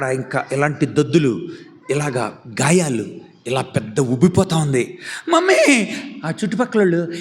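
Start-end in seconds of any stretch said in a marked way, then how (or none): 5.3-5.9 high wailing call; crying out. Acted. Reena wailing for her mummy. Child cries for mother.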